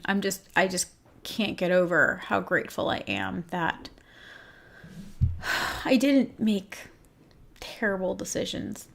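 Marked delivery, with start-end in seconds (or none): none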